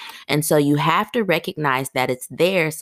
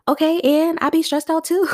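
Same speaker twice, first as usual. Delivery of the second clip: much higher, sassy talk-back voice; playful put-on sass, pitched way up; sassy comeback; 13 semitones higher, 3 dB louder than usual. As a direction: clap back at the guilt cheekily. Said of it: "'cause y'all know how I am".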